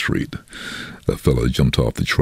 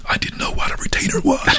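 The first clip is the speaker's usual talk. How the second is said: whispered aside to the listener; hushed, voiceless confession; confiding whisper; 3 dB quieter than usual. Real, not acted. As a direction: drop into a whisper as if admitting a secret. Confiding his ignorance as an aside.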